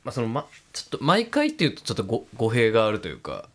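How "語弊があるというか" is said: usual speaking voice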